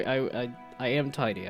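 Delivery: ordinary talk, nothing marked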